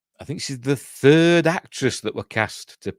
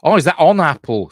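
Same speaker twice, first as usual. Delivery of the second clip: sudden realization; 7 semitones higher, 9 dB louder than usual. Real, not acted. Realizes misreading.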